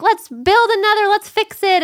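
eager fixer friend. Retold or imitated. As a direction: pitch up and push to fix it fast. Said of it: "one's like".

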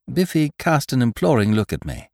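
ordinary delivery, nothing marked

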